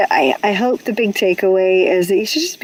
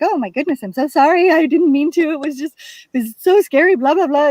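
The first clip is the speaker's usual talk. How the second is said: higher, gushing mock-tearful apology; mock blubbering apology; 6 semitones higher than usual. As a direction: ham up a flustered, teary sorry. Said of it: "she's blubbering about how sorry she is".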